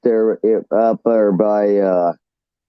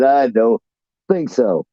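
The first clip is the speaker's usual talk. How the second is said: ordinary talk, nothing marked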